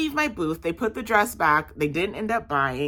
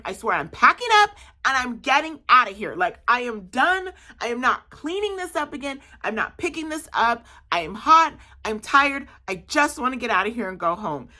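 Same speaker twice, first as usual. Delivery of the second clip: exasperated rant; 6 semitones higher than usual. Retold or imitated. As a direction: vent like you're done with it all. Fighting the wind, exasperated self-talk.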